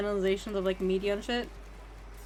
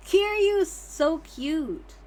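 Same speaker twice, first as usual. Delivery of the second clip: slow gushing; 6 semitones higher, much slower than usual. Acted. Gushing over character.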